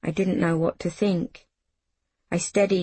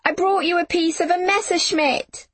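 high-pitched calling, contained volume; girl's excited shout, narrated; excited yell; 12 semitones higher than usual. A narrator's reading. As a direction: call the news out high across a field. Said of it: "I yelled".

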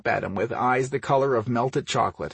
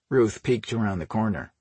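plain reading voice

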